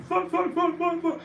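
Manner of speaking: squeaky mock swearing burst; mock compulsive swearing. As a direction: parody a swearing streamer. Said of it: "can't speak without going".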